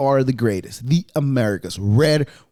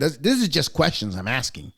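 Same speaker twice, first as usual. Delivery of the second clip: ordinary talk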